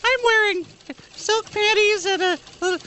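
high breathy come-on voice; falsetto woman voice. Pretend. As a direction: flirt in a fake lady voice. Continuing the fake woman voice.

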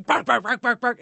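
a mild mock bark; dog barks. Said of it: "Bella's going".

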